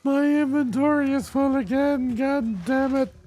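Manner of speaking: loud, very high-pitched griping; exasperated complaint. Real, not acted. Player griping at a full inventory, exasperated.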